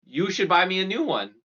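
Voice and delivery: high, entitled whine; bratty argument. Retold or imitated. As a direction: argue like a spoiled kid. Kid arguing with parents.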